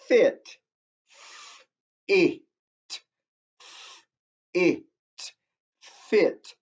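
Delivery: "Fit" cut to "it", "it", then blended into "fit"; sounding out a word. Shown as a demonstration. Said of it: "There it is right there".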